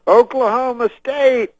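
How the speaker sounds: high, loud, drawn-out stage cry; Bill Cosby. Retold or imitated. Cosby on stage working the stadium crowd, loud impression. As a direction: imitate rousing a huge crowd, long and high. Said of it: "he goes on stage and he goes".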